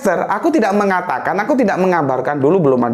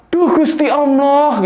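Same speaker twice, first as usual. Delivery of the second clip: a touch of a higher, drawn-out cry; startled mother; 6 semitones higher, slower than usual. Retold or imitated. Speaker recalls the mother's cry at his homecoming, mild mock surprise. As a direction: lightly imitate the mother's stunned exclamation. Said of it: "ketika mama aku bilang".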